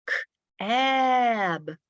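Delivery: "K-, ab" with a pause, then a long high a; "K-, ab" roller-coastered on the vowel; middle sound stretched. Shown as a demonstration. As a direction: hold the vowel in "ab" in a high rise-and-fall. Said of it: "really pop out that middle sound".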